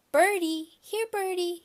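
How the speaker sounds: put-on high, loud little-kid call; toddler calling. A narrator's reading. Little brother calling the pigeons, high toddler voice. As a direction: sing out to the pigeons like a small boy. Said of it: "he called".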